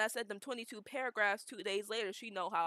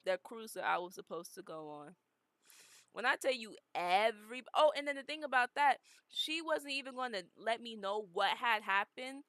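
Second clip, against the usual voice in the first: ordinary delivery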